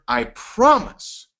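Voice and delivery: "promise" slow and high; stressed promise. Shown as a demonstration. Coach highlighting the key word to trainees, slow stress. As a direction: draw out "promise" slowly. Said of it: "When I say the word".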